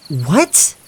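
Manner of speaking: sharp high what; stunned disbelief; shocked. Acted. Shocked reply.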